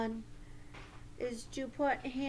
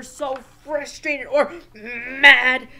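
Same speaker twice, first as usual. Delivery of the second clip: loud mock-mad outburst; acted anger; 12 dB louder, slower than usual. Teacher explaining why we calm down, hammed-up anger. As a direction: push hard into hammed-up anger.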